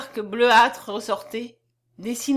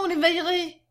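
higher-pitched character cry; excited exclamation; 7 semitones higher than usual. A narrator's reading. Child character exclaims in story.

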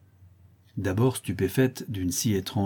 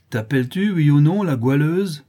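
loud, high-pitched interrogation; harsh demand; 6 semitones higher, 7 dB louder than usual. A narrator's reading. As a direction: force a yes or no out of her. Milkmaid demands a confession. (sommation furieuse)

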